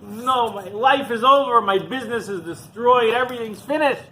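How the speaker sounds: high, loud wail of despair; acted distraught outburst, high and loud; mock loud sobbing. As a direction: wail it as if life is over. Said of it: "he's sobbing loudly".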